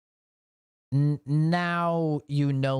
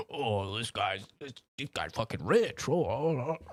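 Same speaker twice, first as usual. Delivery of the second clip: mock outrage; 4 dB quieter than usual. Mimicking Americans reacting to Japan trip.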